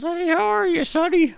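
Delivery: put-on high, old grandma voice, judging; squeaky granny nagging her grandson. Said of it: "And she goes".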